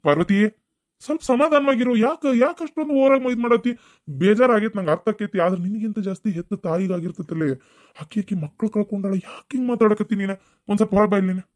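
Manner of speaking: high, loud rebuke to his mother. Acted. Son scolding his mother for her harsh words, loud and high.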